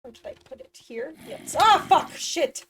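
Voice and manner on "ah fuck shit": startled loud shout, higher; jump scared. Real, not acted. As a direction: yelp and swear from a sudden fright. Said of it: "That didn't jump scare me".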